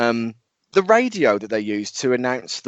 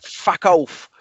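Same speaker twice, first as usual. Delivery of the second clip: put-on high, Cockney-accented East Ender snarl; Cockney impression; 7 semitones higher than usual. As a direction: do Nick Cage as a proper Cockney. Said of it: "I'm gonna do the impression".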